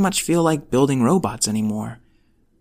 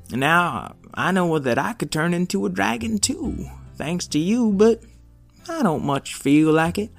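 put-on high, lively wise old robot; cheerful old robot, higher pitch; old rusty robot; 5 semitones higher than usual. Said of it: "I'm a wise old robot".

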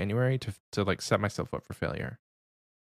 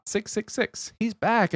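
hyped announcement; 11 semitones higher, slower than usual. Acted. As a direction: announce it like a big return. Hyping a returning supporter.

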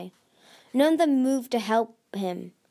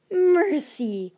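scornful hiss, sharply higher and louder; hissed mercy; 8 semitones higher, 6 dB louder than usual. A narrator's reading. Queen hisses at the traitor begging, sharp and loud. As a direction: spit the word back with contempt. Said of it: "Coral hissed".

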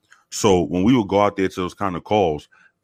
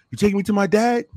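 put-on high, hopeful kid question; imitated hopeful boy; 13 semitones higher than usual. Voicing the boy.

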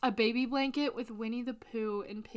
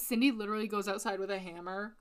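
ordinary talk

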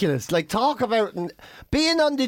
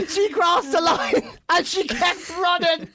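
laughing while telling; 8 semitones higher, slower than usual. Real, not acted. Laughing retelling of the race.

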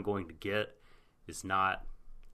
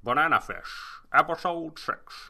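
put-on slow, higher Scottish-accented anchor voice; Sean Connery newscaster; 7 semitones higher, 4 dB louder, slower than usual. Announcing the episode as a Scottish-accented news anchor. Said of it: "sounding more and more like Sean Connery".

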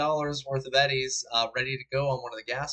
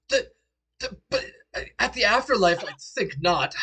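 flustered; 8 semitones higher, 7 dB louder than usual. Acted. Game master voices flustered Jack.

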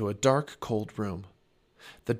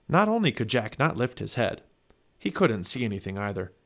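ordinary delivery, nothing marked

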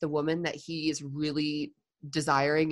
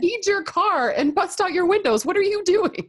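much higher and louder, mock outraged friend; exasperated friend; 13 semitones higher, 6 dB louder than usual. Friend yelling at lovestruck man, mock outrage. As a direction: play the friend who can't believe his choices.

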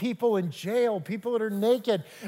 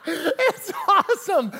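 real delighted laugh, high; bubbling over with joy; 11 semitones higher, 5 dB louder, slower than usual. Real, not acted. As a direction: laugh through the words. Laughs at wedding story.

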